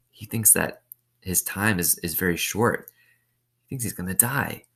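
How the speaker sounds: usual speaking voice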